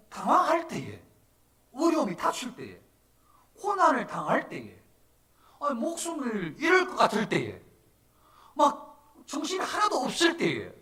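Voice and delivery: high, loud bursts, one per hardship; impassioned preaching. Real, not acted. Pastor listing times when hardship strikes, impassioned preaching. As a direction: hammer out each phrase at sermon pitch.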